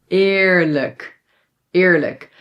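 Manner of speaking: EERLIJK with first vowel nearer ih, then luhk. Shown as a demonstration. Teacher shows E before R, shaded toward I. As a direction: model EERLIJK carefully as eer-luhk. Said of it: "a little bit more like I".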